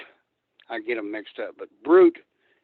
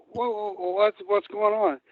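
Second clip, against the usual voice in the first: higher-pitched, mildly startled protest; a touch of imitated panic, pitched up; retold alarm; 7 semitones higher than usual. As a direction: act out being caught off guard. Retold alarm at sudden braking.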